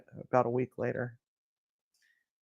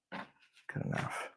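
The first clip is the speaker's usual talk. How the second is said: resigned whisper; 18 dB quieter, slower than usual. Real, not acted. Settling for the result.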